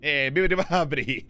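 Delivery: comic mock Italian-American gibberish; Italian-American accent. Streamer's accent drifting off Brooklyn, mock Italian-American accent. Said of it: "It's just an Italian-American accent at this point now".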